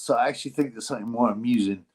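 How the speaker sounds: ordinary talk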